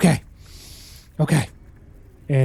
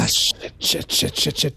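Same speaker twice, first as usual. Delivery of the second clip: softer, breathless curses under the breath; panicked whispered cursing; 4 dB quieter than usual. Acted. Stowaway cursing softly to himself as help is called, whispered panic. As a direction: curse to yourself so she can't hear.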